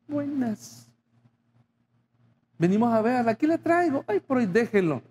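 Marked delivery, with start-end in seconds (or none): 0.1-0.9 high, softer dutiful hello; a sweet hello to a difficult mother-in-law; mock polite greeting. Pastor mimics greeting the mother-in-law, high and soft.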